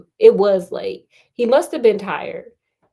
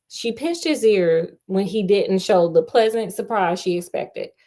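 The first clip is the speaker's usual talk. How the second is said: ordinary delivery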